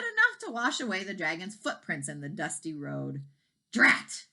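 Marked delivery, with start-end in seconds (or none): 3.7-4.3 louder, higher cross exclamation; thundered. A narrator's reading. Farmer seeing rain wash away footprints, loud cross exclamation. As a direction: bark it like a frustrated farmer. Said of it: "thundered Mister Wagon Wheel".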